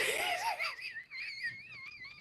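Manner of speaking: very high, thin wheeze of laughter; wheezing laugh. Real, not acted. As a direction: laugh till only a squeak comes out.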